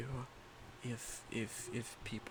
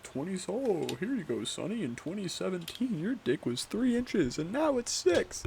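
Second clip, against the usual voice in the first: high, rising mock grandpa voice; old grandpa voice; 8 semitones higher, 4 dB louder than usual. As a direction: tell Sonny his height like a doting grandpa. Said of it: "be like".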